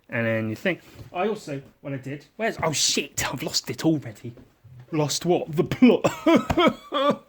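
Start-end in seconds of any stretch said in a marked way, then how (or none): 5.6-7.3 punchline with a laugh. Real, not acted.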